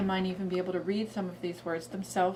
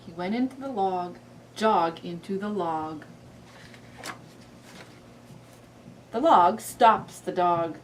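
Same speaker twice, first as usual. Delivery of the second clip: put-on reading to a toddler; 3 dB louder, slower than usual. Shown as a demonstration. Reads frog book.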